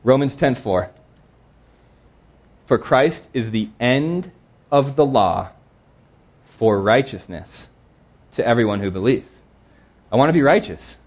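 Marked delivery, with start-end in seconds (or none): 2.7-9.4 slow scripture reading. Acted.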